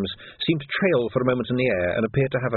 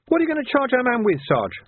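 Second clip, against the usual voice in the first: put-on young Sam voice; 13 semitones higher than usual. A narrator's reading. Sam questions the sergeant.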